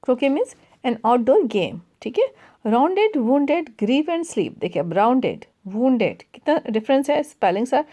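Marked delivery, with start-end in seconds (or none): 2.7-4.6 slow, deliberate recitation; measured classroom poem reading. Acted. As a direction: read the verse line slowly for the class. Reading the poem line aloud.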